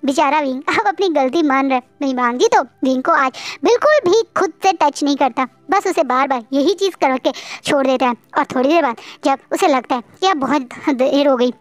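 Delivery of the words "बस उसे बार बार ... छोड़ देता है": plain reading voice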